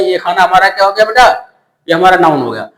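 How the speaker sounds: ordinary talk, nothing marked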